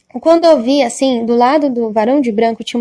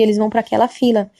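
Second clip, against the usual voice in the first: normal talking voice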